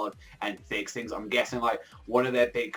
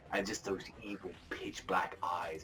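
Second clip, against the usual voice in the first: hushed unvoiced narration; whisper; 4 semitones lower, 10 dB quieter than usual. A narrator's reading. Reviewer describing evil eyes in a whisper. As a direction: whisper it like a secret. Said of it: "menacing aside".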